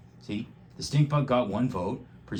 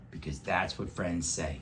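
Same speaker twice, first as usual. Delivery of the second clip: ordinary delivery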